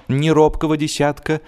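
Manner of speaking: ordinary delivery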